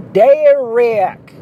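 loud, high cartoonish Southern holler, vowels stretched; mock Southern mother hollering for her son; cartoonish Southern twang. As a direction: call the name out in a drawn-out Southern twang. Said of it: "it's cartoonish. She would say".

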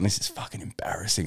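hushed whisper to himself; unvoiced embarrassed whisper; whispered aside. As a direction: breathe it out, mortified. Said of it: "sitting there going".

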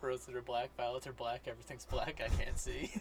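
deadpan poem recital. Pretend. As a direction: recite it dead serious. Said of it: "a poem by [name]".

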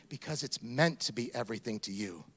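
ordinary delivery

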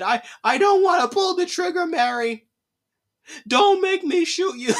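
high, loud, hammed-up pleading gunman; mock tearful villain. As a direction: wail it like a villain feigning reluctance. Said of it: "they sound like a fucking villain".

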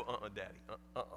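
low, soft refusal; a hint of a frightened kid shaking his head; scared little boy. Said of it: "Sam would go".

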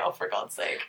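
breathy under-the-breath groan; whispered exasperation. Retold or imitated. Reacting to the same guy, whispered exasperation. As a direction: hiss it out, exasperated. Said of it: "I was like".

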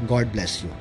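normal talking voice

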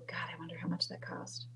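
hushed unvoiced whisper of a private thought. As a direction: whisper it like a secret aside. Whispered thought about commercials.

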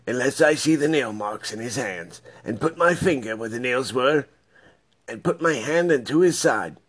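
a hint of a doubtful Thomas; doubting Thomas. Doubting Thomas refuses to believe.

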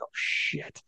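mock-whispered shocked realization; retold hushed dismay. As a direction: whisper it as someone caught off guard, imitating.

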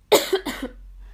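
short burst of coughing; real cough. Real, not acted. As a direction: cough mid-talk, then carry on. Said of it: "Öksürük".

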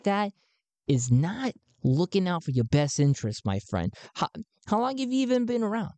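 an excited recruiter greeting a new believer; high-pitched Garn. Acted. Garn welcomes Sven into the cause, pitched high.

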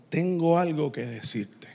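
voz más grave y suave, de frente; serio y quedo. Acted. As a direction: decirlo bajito y grave, como confidencia seria. Imagina al Señor hablándole de frente. (seriedad tranquila en voz baja)

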